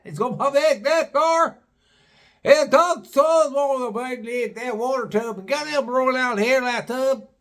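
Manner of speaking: put-on high, garbled good-old-boy voice; incomprehensible local. Garage man talking about the water tub, high mock-local voice. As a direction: pitch it way up and run words together.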